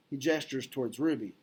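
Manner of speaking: ordinary delivery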